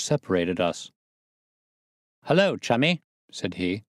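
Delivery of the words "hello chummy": a lightly voiced jaunty convict, pitched higher; a hint of a swaggering prisoner's hello; Prendergast, cheeky convict. A narrator's reading. Prendergast greets his new cell neighbour, lightly voiced and jaunty.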